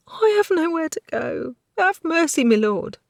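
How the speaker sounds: a hint of a frightened maid begging; lightly voiced tearful maid. Hinkle begging.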